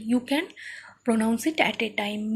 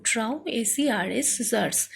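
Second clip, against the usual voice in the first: ordinary talk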